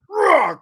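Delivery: high, loud growly shove noise; mock roar. Imitating the guy fending off Ellis, loud roar. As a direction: roar as you push someone off.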